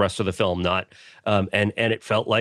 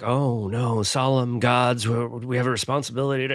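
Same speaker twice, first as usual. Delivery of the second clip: mock solemn. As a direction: intone it with exaggerated gravity. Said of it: "the rest of the movie was like".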